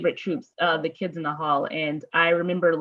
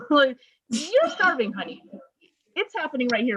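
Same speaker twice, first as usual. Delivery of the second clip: higher, laughing mock sympathy, slowed down; teasing through laughter; 6 semitones higher than usual. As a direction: pat her on the head, laughing.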